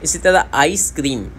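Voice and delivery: usual speaking voice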